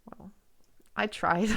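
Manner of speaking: ordinary talk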